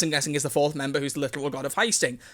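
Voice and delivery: plain reading voice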